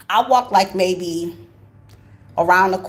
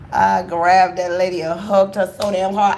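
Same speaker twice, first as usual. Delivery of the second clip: ordinary talk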